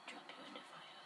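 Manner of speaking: ordinary talk, nothing marked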